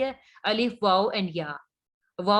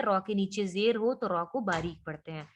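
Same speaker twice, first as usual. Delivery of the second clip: usual speaking voice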